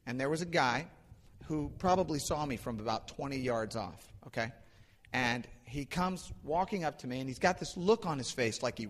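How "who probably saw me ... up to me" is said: ordinary delivery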